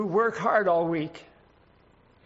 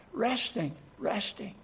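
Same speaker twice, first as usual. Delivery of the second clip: fading soft repetition; 2 semitones higher, 6 dB quieter, slower than usual. Acted. Preacher savours heavenly rest.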